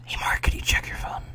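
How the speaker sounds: playful whisper into the mic; giggly prank whisper. Pretend. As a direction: whisper it, barely holding in a laugh. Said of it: "Can you whisper into the mic".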